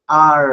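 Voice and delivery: আর said loud and held; r-controlled demo. Shown as a demonstration. Teacher loudly modelling the r sound. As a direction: punch out আর loudly. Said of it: "যেরকম".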